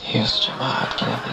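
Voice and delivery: breathy stage whisper; mysterious whisper. Shown as a demonstration. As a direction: whisper your own name mysteriously. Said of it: "I could come on stage just like this".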